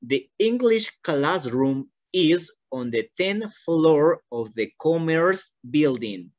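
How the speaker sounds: slow, carefully spaced teacher reading; slow model reading. Shown as a demonstration. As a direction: read it out slowly, word by word. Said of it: "voy a leer una vez más". (lectura modelo lenta)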